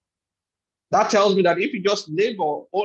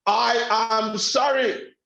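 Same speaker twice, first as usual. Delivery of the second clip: first way of saying it; 4 semitones higher, slower than usual. Shown as a demonstration. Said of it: "sentences like".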